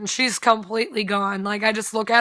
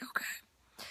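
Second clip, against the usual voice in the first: breathy whisper, almost no voice; shy whisper; 20 dB quieter than usual. Retold or imitated. Shy sister answering Dan, whispered okay. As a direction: whisper it, too shy to speak.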